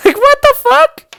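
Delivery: high laugh that spills into the words; laughing disbelief. Real, not acted. Laughing in disbelief at the senator's insult.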